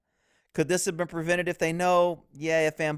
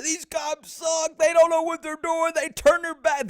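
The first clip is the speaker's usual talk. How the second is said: high mocking imitation of critics; mock cop haters; 10 semitones higher than usual. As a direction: mimic the critics with scorn. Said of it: "I'm gonna have the cop haters".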